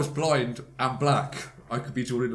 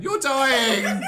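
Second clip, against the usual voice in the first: high, hammed-up shriek of alarm; mock panicked mum; 13 semitones higher, 10 dB louder than usual. Mum runs in to feverish child, mock panicked shout. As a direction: imitate a mother shouting in panic.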